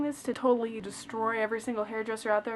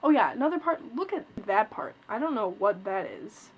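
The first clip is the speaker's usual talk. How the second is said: ordinary talk, nothing marked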